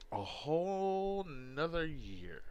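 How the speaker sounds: slow and stretched, each word held; slow drawn out. Shown as a demonstration. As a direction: draw out A whole nother year slowly.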